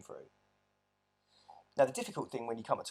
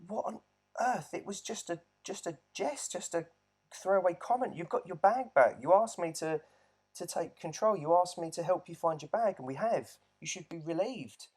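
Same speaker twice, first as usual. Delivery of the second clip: higher and louder, exasperated disbelief; a stunned man defending himself to his angry partner; incredulous protest; 7 semitones higher, 4 dB louder than usual. Retold or imitated. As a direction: protest that it was only a joke, pitch climbing. Retorting to partner's angry accusation.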